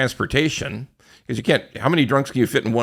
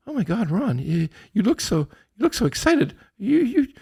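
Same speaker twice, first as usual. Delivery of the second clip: high-pitched mock-worried commenter; concerned commenter; 13 semitones higher, 3 dB louder than usual. Viewer fretting over Ron's blood pressure, high mock concern. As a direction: put on a high, fretting voice, louder by the end. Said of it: "we get little comments like".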